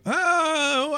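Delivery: high, soft, drawn-out doubtful oh; mock skepticism. Voicing doubters before the eruption.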